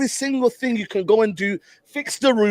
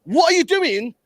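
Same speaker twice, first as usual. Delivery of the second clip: exasperated shouting; 9 semitones higher than usual. Real, not acted. Watching match, exasperated at keeper.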